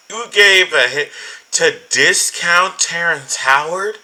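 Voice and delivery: high, loud, slow incredulous cry; can't believe what he just read; shocked disbelief. Real, not acted. Reacting to the tweet with loud, slow disbelief.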